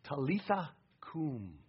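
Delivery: put-on hushed and slow "Talitha cum" to a little girl; father to his dead daughter, very softly; tender whisper-soft call. Shown as a demonstration. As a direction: wake a beloved child gently. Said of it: "take your little girl by the hand and say".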